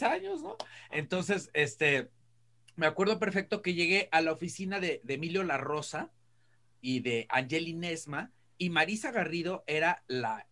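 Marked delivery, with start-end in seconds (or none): none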